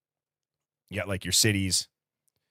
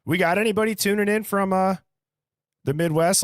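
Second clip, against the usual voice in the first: pitched way up, a touch louder, hailing the viewers; calling out to chat; 11 semitones higher, 4 dB louder than usual. Real, not acted. Streamer hailing viewers in chat, asking where they're from, high excited call-out. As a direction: sing out the question to the whole chat.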